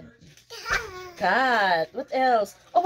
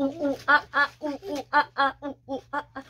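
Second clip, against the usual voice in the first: monkey noises; 4 semitones higher, much faster than usual. Pretend. Monkey sound for toddler.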